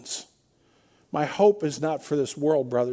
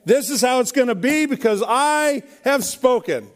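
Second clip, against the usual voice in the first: hammed-up kingly proclamation, pitched higher; mock royal decree; 6 semitones higher than usual. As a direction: announce it like a king laying down the law, tongue in cheek. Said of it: "That's so sweet when you do that".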